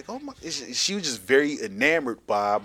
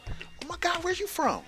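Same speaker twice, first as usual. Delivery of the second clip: high falsetto gushing; mock excited woman; 5 dB quieter than usual. Southern women hearing you're from Philly, high mock gush. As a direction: squeal it like a smitten fan.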